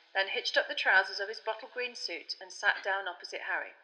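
usual speaking voice

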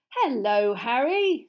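loud, lilting, cheery hello; bright greeting. Acted. Mister Weasley greeting Harry, bright and cheery. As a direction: greet brightly, lifting on the name. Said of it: "said Mister Weasley brightly".

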